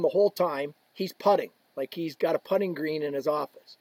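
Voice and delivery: usual speaking voice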